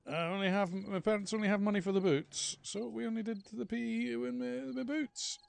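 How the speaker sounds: schoolboy explaining to the teacher why he has no trainers; whiny younger self. Retold or imitated. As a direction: squeak it like a sulky schoolboy. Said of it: "And I say".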